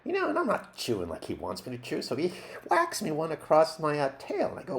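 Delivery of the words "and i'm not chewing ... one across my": ordinary delivery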